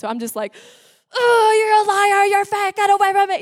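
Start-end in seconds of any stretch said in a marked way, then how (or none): 1.1-3.4 retold angry screaming. Missionary alone in the house rages at God, acted-out angry screaming. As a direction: act out screaming mad, pitched way up. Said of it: "I'm screaming and I'm mad".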